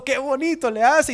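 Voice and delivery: put-on high, delighted gush over a child; gushing proud parent. Proud mom praising her child's show, excited gush. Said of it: "uno bien emocionado".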